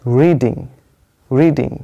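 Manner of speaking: Reading with the stress on the first syllable री; primary stress demo. Shown as a demonstration. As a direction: lean on री, let ding fall away in reading. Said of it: "इस वाले syllable पे stress है".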